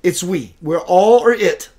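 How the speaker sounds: ordinary talk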